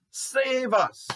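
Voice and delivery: high imitated cry for rescue; crowd's shout. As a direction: call out high, as the crowd would. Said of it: "even though they shouted".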